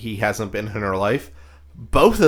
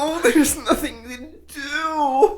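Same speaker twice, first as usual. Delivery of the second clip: high, slow, hammed-up fake sobbing; mock crying whine; 13 semitones higher, slower than usual.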